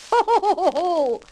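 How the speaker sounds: high, loud piggy ho-ho-ho; jolly ho ho laugh. Acted. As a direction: belt out a teasing ho ho. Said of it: "laughed the second little pig".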